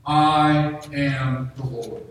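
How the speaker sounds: "I am the Lord" said very slowly, each word weighted; slow emphasis. Shown as a demonstration. Pastor repeating the key phrase to the church, slow and weighty. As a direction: lean on every word of "I am the Lord". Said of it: "one phrase that stuck out to you".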